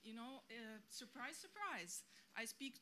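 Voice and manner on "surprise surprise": slow, dry "surprise, surprise"; sarcastic. Real, not acted. To the panel about her own joblessness, wry and sarcastic.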